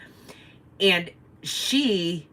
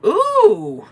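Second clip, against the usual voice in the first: surprised bus lady; 9 dB louder than usual. Retold or imitated. Bus lady noticing scooter damage.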